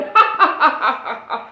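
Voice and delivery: loud jeering ha ha laugh from the class; mocking laughter. Classmates laughing at the bean, loud jeering laughter. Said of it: "They go".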